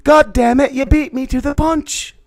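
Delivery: high squeaky exasperation; over-the-top waif impression, loud at first; Charles. Co-host beaten to his catchphrase, squeaky exasperated waif voice. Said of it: "I was doing Charles".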